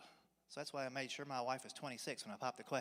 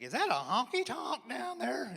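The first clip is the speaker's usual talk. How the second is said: high, slow squeaky puberty voice; mock a voice cracking mid-word; cracking teen voice; 9 semitones higher, 6 dB louder, slower than usual. Dad mimics teen boys in his car, squeaky cracking.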